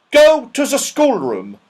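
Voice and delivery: put-on high, loud scolding old dame; Dame Snap, sharp. A narrator's reading. Stern old dame scolding a scruffy pupil, loud and high. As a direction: bark it like a cross schoolmistress. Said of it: "said Dame Snap".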